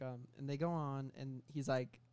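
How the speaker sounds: ordinary delivery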